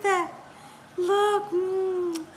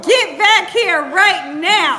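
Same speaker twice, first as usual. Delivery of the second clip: loud, slow, every word laid down; mock stern command; 6 dB louder, slower than usual. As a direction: play the strict parent calling him back. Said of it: "Y'all see how I'm talking to him?".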